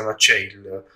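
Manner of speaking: ordinary talk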